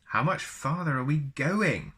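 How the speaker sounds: ordinary delivery, nothing marked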